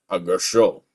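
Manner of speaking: low, put-on brooding mumble; mock edgy voice. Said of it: "trying to be so edgy".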